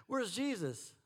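usual speaking voice

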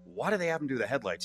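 soft, offhand retold question; matter-of-fact. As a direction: toss it out as a plain observation. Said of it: "it was, like, very matter of fact".

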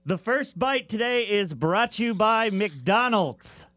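measured, lifted presenter delivery; sponsor read. Real, not acted. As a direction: announce the segment and its sponsor, drawn out. Reads the sponsored segment intro.

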